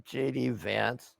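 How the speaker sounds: ordinary delivery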